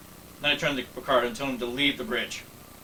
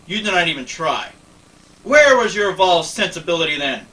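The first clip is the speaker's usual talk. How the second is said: louder, rising accusation; heated challenge; 5 semitones higher, 8 dB louder than usual. Acted. Lily accusing Picard of not trying, heated and loud. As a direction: corner him with the question.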